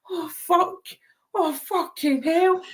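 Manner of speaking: high, drawn-out retold dismay; mock dread. Walking in beside starstruck crowd's idol, high mock dismay. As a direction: squeak it out, overwhelmed.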